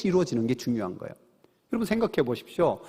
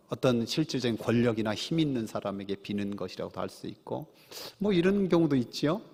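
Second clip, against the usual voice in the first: ordinary delivery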